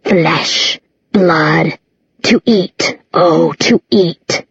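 slow, hissing monster hunger; gurgling swamp creature. A narrator's reading. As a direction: savor each word like a starving beast. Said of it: "as it gurgled and hissed".

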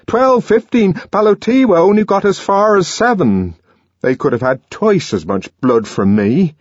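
high, loud impassioned plea; rising passionate lament; anguished outburst. A narrator's reading. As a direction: pour it out in desperation. Young man's anguished outburst.

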